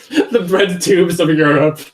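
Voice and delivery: laughing. Real, not acted. Laughing echo of S1's phrase.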